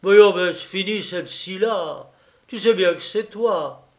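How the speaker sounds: growing impatient. A narrator's reading. Husband tired of his wife's game, growing impatient. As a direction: cut the nonsense short, impatiently. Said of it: "Il commençait à s'impatienter".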